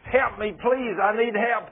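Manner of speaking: high, loud imitated cry for help; desperate plea. Man on his knees begging God for help, acted desperate plea. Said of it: "on my knees, my hands raised".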